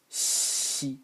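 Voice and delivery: xi as an unvoiced hiss; just the hiss for xi; Chinese xi, noise only. Shown as a demonstration. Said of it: "This is only the noise".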